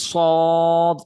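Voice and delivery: সদ said thick and loud; thick, emphatic সদ; heavy sad. Shown as a demonstration. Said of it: "এটাকে একটু মোটা করে পড়তে হবে".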